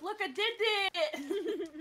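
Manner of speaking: proud squeal, laughing, slow; laughing triumphant cheer; excited. Retold or imitated. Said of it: "I'm always like".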